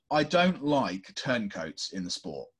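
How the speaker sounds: ordinary talk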